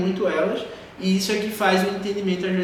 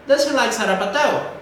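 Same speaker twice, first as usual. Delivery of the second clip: "Does he like sarapatel" pitched high, lifting at the end; rising question intonation; 5 semitones higher, 5 dB louder than usual. Shown as a demonstration. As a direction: send "sarapatel" upward as a louder question. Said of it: "Entonação lá pra cima". ("Does he like sarapatel" com entonação lá pra cima)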